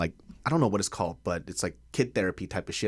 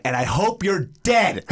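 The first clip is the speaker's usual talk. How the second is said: mock curse; 10 semitones higher, 4 dB louder, slower than usual. Mock insult to ex-friend.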